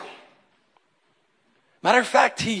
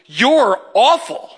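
slow, drawn out, a little louder; preacher's God bluntly telling the believer he's awful; mock God verdict; 3 semitones higher, 4 dB louder, much slower than usual. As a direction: lay it on slowly as a dry divine verdict. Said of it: "He was just like".

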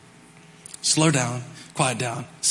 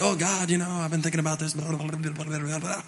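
softer, droning mock prayer; mock rambling prayer; 6 dB quieter than usual. Pastor imitating a wordy prayer, softening ramble. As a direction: trail off into endless babble. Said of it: "We fill up our whole prayer time with like".

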